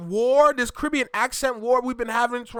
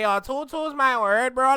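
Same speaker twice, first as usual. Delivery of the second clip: nasal, higher Toronto slang voice; Toronto accent; 5 semitones higher, 3 dB louder than usual. Shown as a demonstration. Demonstrating Toronto slang for listeners, nasal Toronto accent. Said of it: "when you have the Toronto accent".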